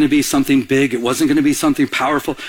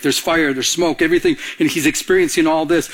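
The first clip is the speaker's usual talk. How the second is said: ordinary delivery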